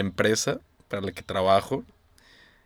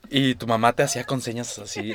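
ordinary talk

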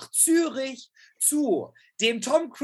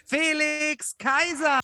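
drawn-out high boxing ring call; ring announcer; 8 semitones higher, slower than usual. Acted. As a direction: stretch the name like a fight announcer. Introducing guest like ring announcer.

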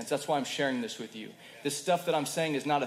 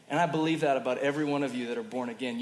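usual speaking voice

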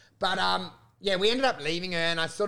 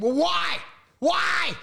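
higher, exasperated yell; retold yelling; 11 semitones higher, 3 dB louder, much slower than usual. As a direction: demand it in a raised, high-pitched voice.

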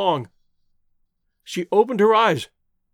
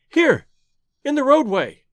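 high, raised call giving a location; calling in reply; 7 semitones higher, 4 dB louder than usual. A narrator's reading. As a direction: call out to guide a rescuer in. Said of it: "she called in reply".

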